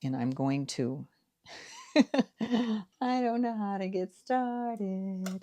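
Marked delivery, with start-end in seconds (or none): 1.6-5.4 laughing. Real, not acted. Nervous start on camera.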